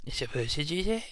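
normal talking voice

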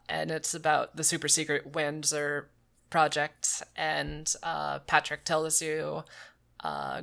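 usual speaking voice

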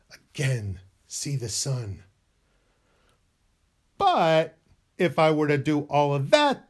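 normal talking voice

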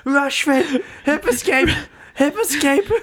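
high-pitched urgent cornering through laughter; mock cornerman yell. Coach yelling to his downed fighter, mock-panicked. Pretend cornerman calling out moves.

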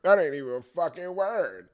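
mocking quote of an indignant listener; mock objector. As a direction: put on a heckler's protesting voice. Voices imagined critics' objection.

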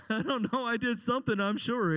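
usual speaking voice

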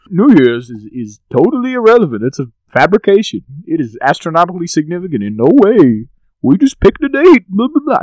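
high, pompous know-it-all voice; mocking Neil deGrasse Tyson. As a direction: lecture it in a smug, high nerd voice. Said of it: "as he's so fond of pointing out".

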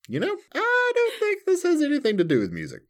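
very high, fast voice; high-pitched realization. Retold or imitated. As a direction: squeak it in dawning realization. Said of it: "I'm like".

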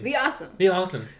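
loud, big show-host hype; talk-show host building up the guest, loud and excited; hype intro. Acted. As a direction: sell the guest like an announcer.

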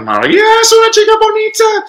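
exaggerated high-pitched questioning all through "Maria es una chica bonita"; question from the start. Shown as a demonstration. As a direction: pitch jumps up at "Maria" and stays there to "bonita". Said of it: "right from the very beginning".

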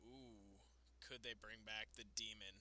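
low, very soft, awed speculation; hushed teasing. Pretend. Host teasing the Demon's return, hushed suspense. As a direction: tease it in a hush, like a secret.

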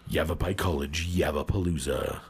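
deep dramatic trailer voice; movie trailer voice. Shown as a demonstration. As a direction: announce it like an epic movie trailer.

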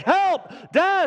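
hammed-up, high cry for help; retold scream for help. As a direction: imitate a stuck kid screaming for Dad. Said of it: "I start screaming bloody murder".

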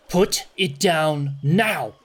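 slow, drawn-out called-out order; slow, forceful threat; commanding yell from afar. Acted. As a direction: call a stern warning across the water. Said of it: "you hear a voice yell out".

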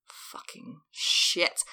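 slow, whispered curse; stunned swearing. Retold or imitated. Realizing the playbill mistake, stunned slow whisper. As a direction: drag it out under the breath, barely voiced.

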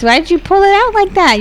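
normal talking voice

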